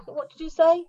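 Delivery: high-pitched, baffled asking to repeat; mock puzzlement. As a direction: act out not catching what was said. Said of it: "'cause it's not quite clear".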